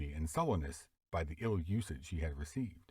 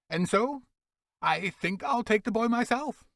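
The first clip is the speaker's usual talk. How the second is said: much higher, louder character voice; undertaker voice; 8 dB louder than usual. Acted. Undertaker claiming the boy, raised voice. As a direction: voice the undertaker deciding.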